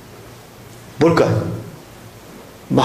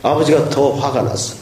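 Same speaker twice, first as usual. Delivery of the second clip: usual speaking voice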